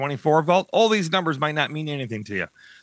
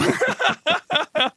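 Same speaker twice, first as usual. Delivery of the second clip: a bright burst of real laughter, pitched up; high laugh. Real, not acted. Guest laughing at her own joke to the host, high burst of laughter.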